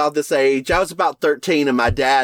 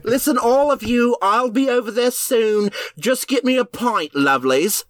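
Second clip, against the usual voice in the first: higher, hammed-up Cockney pub voice; jokey fake Cockney accent, higher pitch; mock Cockney accent; 8 semitones higher than usual. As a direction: play a cheery Cockney calling for a pint. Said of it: "particularly those from the Cockney region".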